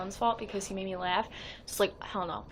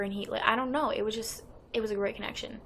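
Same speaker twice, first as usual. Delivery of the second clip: normal talking voice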